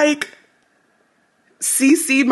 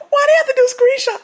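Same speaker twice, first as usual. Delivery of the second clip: high, fast whine; rapid high-pitched complaint; wailed protest; 11 semitones higher, faster than usual. Real, not acted. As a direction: blurt it out high, mortified. Protesting the screenshot.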